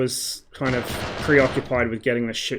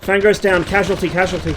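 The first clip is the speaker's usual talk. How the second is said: man down shout; 4 semitones higher, 7 dB louder than usual. Real, not acted. Calling out downed teammate.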